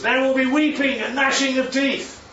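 a preacher hamming up doom to street lads; mock preacher thundering. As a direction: boom it out like a fire-and-brimstone minister. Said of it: "And he says".

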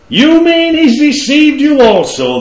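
high, loud and slow, sneering at the guards; scornful retort. A narrator's reading. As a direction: fling it back with contempt. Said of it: "the Pharisees retorted".

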